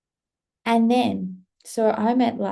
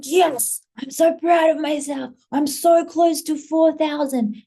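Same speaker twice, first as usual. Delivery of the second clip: proud excitement; 7 semitones higher, 4 dB louder than usual. Retold or imitated. Creator retelling her pride at nearing four thousand, excited. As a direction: cheer yourself on, high and emphatic. Retold pride at follower growth.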